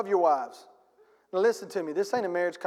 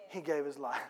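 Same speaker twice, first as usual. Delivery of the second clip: hushed, low; 7 semitones lower, 11 dB quieter than usual. Real, not acted. Repeated line, dropped voice.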